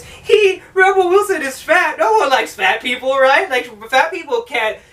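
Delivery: high-pitched jeering voice; mocking idiotic humour. Movie mocking Rebel Wilson, high jeering voice. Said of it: "excuse for comedy is".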